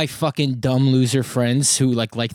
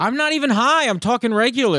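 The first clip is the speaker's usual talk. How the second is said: much higher, pleading defence; indignant protest; 9 semitones higher than usual. Real, not acted. Comic denying to friend he is high, indignant. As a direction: insist in a high-pitched voice.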